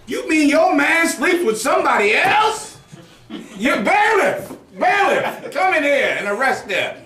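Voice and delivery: high, incredulous mock judge, shouting for the bailiff. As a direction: ham up an outraged judge calling the bailiff, laughing. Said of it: "I'm sure that judge was like".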